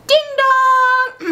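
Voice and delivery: high, loud chiming doorbell; sung doorbell. Acted. Visitors ringing at the director's door, sung doorbell. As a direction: sing out a two-note chime.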